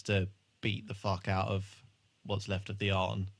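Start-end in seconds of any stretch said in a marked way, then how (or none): none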